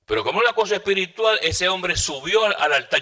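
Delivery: climbing preacher's fervor; fervent rise. Real, not acted. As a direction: lift the voice with fervor. Preacher's testimony, rising fervor.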